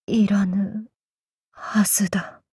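breathy self-doubting whisper. Acted. Kagekiyo whispering his doubt to himself. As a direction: let the doubt slip out under breath.

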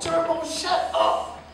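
high, slow, exasperated order; irritated. Acted. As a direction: cut him off sharply.

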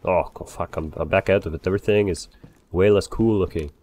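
ordinary talk

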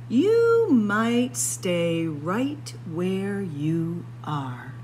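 usual speaking voice

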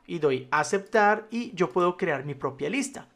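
normal talking voice

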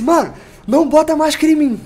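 loud, high panicked yell. Retold or imitated. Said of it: "eu comecei a gritar". (grito desesperado e agudo)

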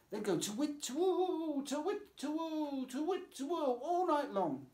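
high hooting owl call; owl imitation. Mother hooting like an owl for Lucy. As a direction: hoot it like an owl at night. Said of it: "her mother's imitation of an owl".

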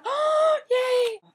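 very high, loud squeal of delight; squealed yay. Retold or imitated. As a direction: shriek it like great news. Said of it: "I'm like".

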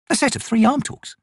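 high, wondering tone; musing. A narrator's reading. Corporal puzzles over the arm rings, musing. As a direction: think it over aloud. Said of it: "Picker mused".

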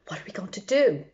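fast frightened whisper; scared whisper. Acted. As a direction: hiss it out in panic. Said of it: "Now they're scared".

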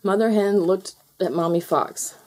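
ordinary delivery, nothing marked

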